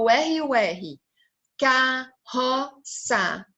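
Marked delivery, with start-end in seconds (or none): 1.6-3.4 CARROÇA very slowly as car-ro-ça; three separate beats on carroça, pauses between; split into syllables. Shown as a demonstration. Teacher separating the double R, syllable by syllable. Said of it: "quando eu separei".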